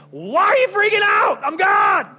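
high, loud playful Jesus voice; Jesus rebuking. Retold or imitated. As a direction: tease the scared disciples loudly. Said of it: "Jesus is like".

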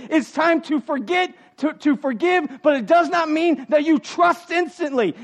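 loud, driving preacher's cadence; preaching at full force. Acted. Pastor urging the congregation to move on, full-force preaching. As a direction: pound each "it's time" home.

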